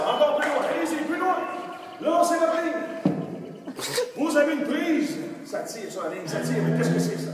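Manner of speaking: high, loud, pressing commands; excited stage hypnotist; urgent hypnotist's scene. Acted. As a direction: drive the hypnotized crowd through a shark attack. Hypnotist's fishing scene, urging.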